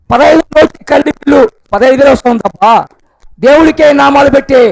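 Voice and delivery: loud, high complaining rant; loud street performer patter. Acted. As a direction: shout the grievance like a hawker. Trader rants about power bill. (గట్టిగా అరుస్తూ వాపోవడం)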